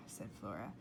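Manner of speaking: plain reading voice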